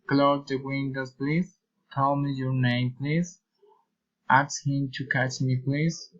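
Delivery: "please" lifts at the end; polite requests rising on "please"; request intonation. Shown as a demonstration. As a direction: raise each "please". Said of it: "Request intonation".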